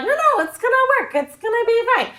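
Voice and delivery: high, fast, breezy mock reassurance; eager insistence. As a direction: brush off the worry in a high, hurried voice. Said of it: "But the Israelites were like".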